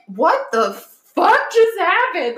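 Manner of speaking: slow, high stunned question; stunned disbelief. Retold or imitated. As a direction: drag out the shock. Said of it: "I'm just like".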